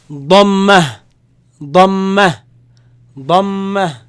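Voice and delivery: Dhamma called out loud with a doubled m; loud drill. Shown as a demonstration. Teacher modeling dhamma loudly for students. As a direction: hold the mm in Dhamma. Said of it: "So put double meaning together".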